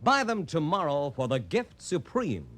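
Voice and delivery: ordinary talk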